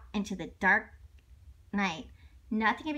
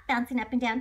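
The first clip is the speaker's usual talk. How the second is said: ordinary delivery, nothing marked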